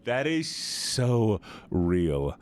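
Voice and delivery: very slow, stretched "so" and "real"; drawn-out agreement. Real, not acted. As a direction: linger on each word, stretching "so". Repeats it more drawn out.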